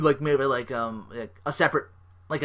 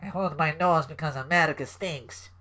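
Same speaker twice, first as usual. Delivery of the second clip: put-on Russian accent, a bit higher; bad Russian accent; 4 semitones higher than usual. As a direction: play a cartoon Russian villain. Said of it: "really bad stereotypical Russian accent".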